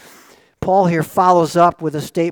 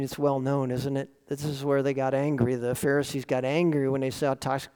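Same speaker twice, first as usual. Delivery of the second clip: ordinary talk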